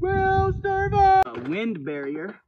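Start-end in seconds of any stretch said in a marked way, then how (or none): 0.0-1.4 high, slow sing-song catchphrase. Pretend. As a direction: drag it out up high. Drawn-out catchphrase.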